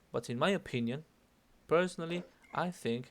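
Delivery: opinion and personally lilted upward; singsong, question-like. Shown as a demonstration. Teacher showing opinion openers that sound like questions, singsong. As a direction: leave opinion and personally hanging before the comma. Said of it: "Suena como que estoy preguntando".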